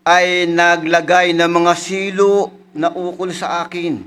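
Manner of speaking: elevated preacher's reading; scripture reading. Acted. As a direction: proclaim the psalm to the congregation. Reads a psalm aloud.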